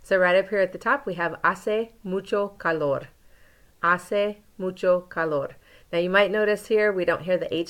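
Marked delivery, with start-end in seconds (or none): 1.4-3.1 "Hace mucho calor" said slowly, silent H; slow model pronunciation. Shown as a demonstration. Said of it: "for some pronunciation practice". 3.8-5.6 "Hace mucho calor" said slowly, silent H; slow model pronunciation. Shown as a demonstration. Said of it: "for some pronunciation practice".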